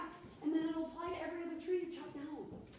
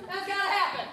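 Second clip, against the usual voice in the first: loud, higher, amused insistence; emphatic exclamation; 6 semitones higher, 12 dB louder than usual. Real, not acted. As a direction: burst out with it, half laughing. Coach exclaims while laughing.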